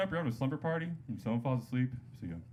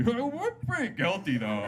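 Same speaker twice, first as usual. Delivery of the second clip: put-on high, slow drunken confession; drunk sidekick; 8 semitones higher, 3 dB louder than usual. Drunk sidekick spoiling the plea in court, blundering. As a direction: drag it out as a hapless drunk. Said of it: "that's his drunk sidekick".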